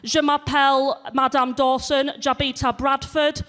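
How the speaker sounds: put-on swan in, French with a Leeds accent; broad northern French. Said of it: "she's so northern".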